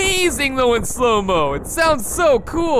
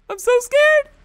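mock scared; 11 semitones higher than usual. Enemies swarm, mock fear.

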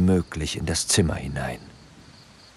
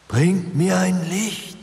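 higher still, slow, eerie call rising at the end; rising ghostly wail; 12 semitones higher, slower than usual. A narrator's reading. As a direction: let the ghost's plea climb. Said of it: "wimmerte die Stimme".

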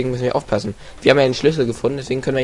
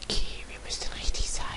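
quick sneaky whisper; hushed sneaking whisper; 12 dB quieter, faster than usual. Acted. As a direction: whisper it fast so nobody hears. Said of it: "ich muss jetzt automatisch leiser resen".

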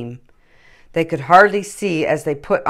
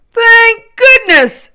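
much higher, louder, slow sigh of relief; relieved Santa; 12 dB louder, slower than usual. A narrator's reading. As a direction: Santa overjoyed at the glow.

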